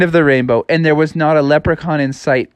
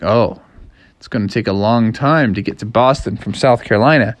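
lower-pitched street fellow's voice; local fellow; 6 semitones lower than usual. A narrator's reading. Man on the street to a lost frog, low and doubtful. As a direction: give discouraging news in a low voice.

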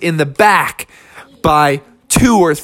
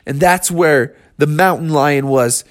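ordinary delivery

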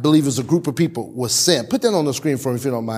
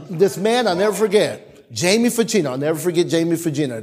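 usual speaking voice

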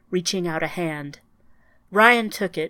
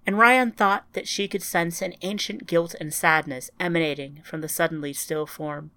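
usual speaking voice

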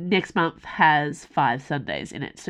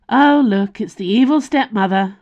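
bright, higher and louder mock greeting; cheerfully; 4 semitones higher, 7 dB louder than usual. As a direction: tease her cheerfully, voice up and loud. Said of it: "said Yana cheerfully".

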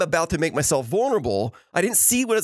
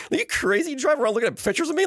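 mock amazed wife; 9 semitones higher, faster than usual. Wife's amazed reply, retold.

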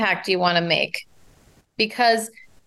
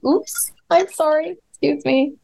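higher, startled oops and sorry; sudden apology; 7 semitones higher than usual. Real, not acted. Coach stumbles mid-answer and apologises to host, startled. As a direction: catch yourself and apologise quickly.

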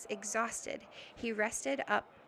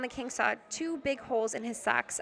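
plain reading voice

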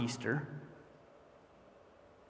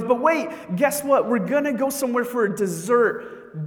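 a higher-pitched, hyped-up husband; playful hype; 8 semitones higher, 5 dB louder than usual. Retold or imitated. Husband teasing his wife with a dessert guess, playfully hyped. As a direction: tease the next surprise with raised pitch.

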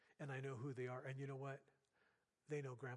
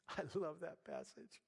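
laughing. Real, not acted. Laughs, then repeats.

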